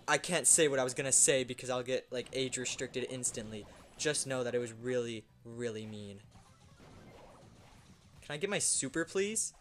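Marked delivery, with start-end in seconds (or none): none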